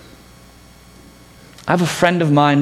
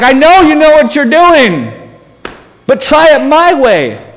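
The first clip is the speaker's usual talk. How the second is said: higher, louder, insistent call; Jesus yelling back; 8 semitones higher, 6 dB louder than usual. Acted. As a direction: shout back to the boat, firm but kind. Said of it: "he's yelling back".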